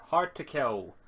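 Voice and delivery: ordinary delivery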